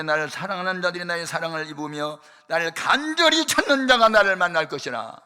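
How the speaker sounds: booming solemn reading of holy text; loud scripture reading. Acted. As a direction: proclaim the verse loudly, like from a pulpit.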